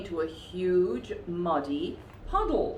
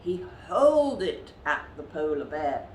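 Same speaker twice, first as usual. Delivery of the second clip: ordinary delivery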